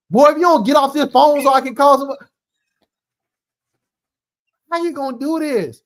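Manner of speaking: high, loud parental outburst; scolding parent. Retold or imitated. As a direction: order the boy off the phone. Voices parent ordering boy off phone.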